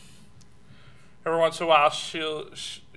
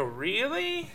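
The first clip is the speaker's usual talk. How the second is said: high, softer, rising query; doubtful wife; 8 semitones higher, 5 dB quieter than usual. Retold or imitated. Wife hearing she's wonderful: mock doubtful question. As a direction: imitate a wife fishing for reassurance. Wife's reply acted out.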